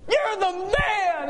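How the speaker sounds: high, slow, elated cry of joy; overjoyed exclamation. Retold or imitated. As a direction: stretch it out, thrilled to bits. Overjoyed reaction to God.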